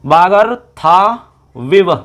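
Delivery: वागर था विव very slowly, in three separate pieces; word-building demonstration. Shown as a demonstration. Teacher builds the word for students, slow segmented pronunciation. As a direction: stretch वागर and था, pausing before विव. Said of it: "तो बन जाएगा".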